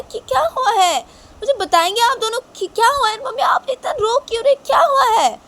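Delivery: very high, loud wailing questions; near-tears panic, demanding an answer; distraught Shanaya. Acted. Shanaya demands why they are crying.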